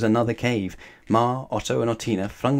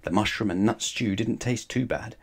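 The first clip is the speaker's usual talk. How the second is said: plain reading voice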